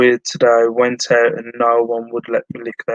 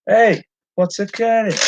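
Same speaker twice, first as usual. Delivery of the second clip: higher-pitched Welsh catchphrase voice; Welsh accent; 9 semitones higher than usual. Shown as a demonstration. Seller shows off the only Welsh they can do, Welsh accent. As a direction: do a playful Welsh lilt. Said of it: "About the only Welsh stuff I can do".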